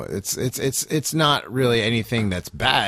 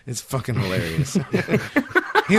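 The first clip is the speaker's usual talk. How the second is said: normal talking voice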